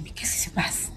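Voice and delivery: hushed, breathless whisper; a frightened night whisper. Retold or imitated. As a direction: whisper it, scared of the noise outside. Woken by noises at night.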